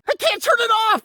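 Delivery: high panicked yelp; high-pitched panic; panicked cry. Acted. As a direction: cry out in alarm that it won't stop. Panicked, machine malfunctioning.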